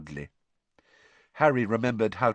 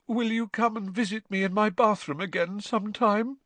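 put-on high, plaintive ghost-girl voice; mournful; 12 semitones higher than usual. A narrator's reading. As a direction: ask forlornly in a high, drawn voice. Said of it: "asked mournfully".

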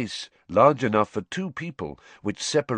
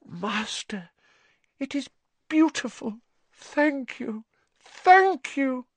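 high, breathless thanks rising; awed gratitude. A narrator's reading. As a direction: gush with grateful awe. Wormtail awed by new hand.

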